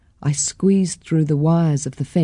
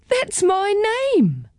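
excited; slower than usual. A narrator's reading. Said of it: "I shouted".

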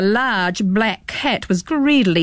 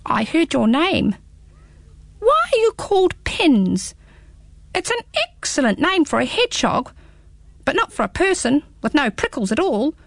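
squeaky small creature, teasing; little hedgehog voice; 6 semitones higher than usual. Acted. Hedgehog speaks in story.

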